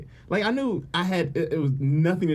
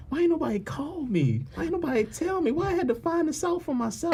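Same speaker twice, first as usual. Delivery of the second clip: high, aggrieved complaint; high-pitched indignant whining; 11 semitones higher than usual. Retold or imitated. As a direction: rise in pitch with each why, half laughing. Retold upset on roadside.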